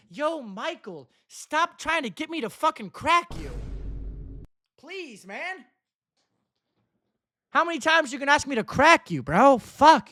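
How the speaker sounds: high, loud fed-up yell; exasperated outburst. Real, not acted. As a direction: shout him down, fed up. Annoyed at repeated chat asks.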